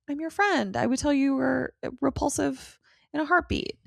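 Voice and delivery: lightly voiced cute best friend; Willow. Best friend reassuring poor Buffy, lightly mimicked cute manner. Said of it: "Willow is so cute that she's like".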